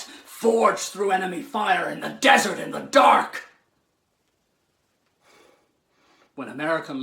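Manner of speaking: fervent speech building to a shouted climax; rousing oratory. Acted.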